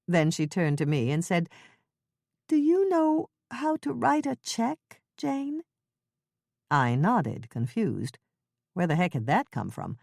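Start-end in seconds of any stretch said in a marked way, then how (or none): 2.5-5.6 put-on high, slow, worried elderly woman; Ethel's fretful old voice. Old neighbor fretting to Jane over tea, high and slow. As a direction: quaver it like a flustered old neighbor.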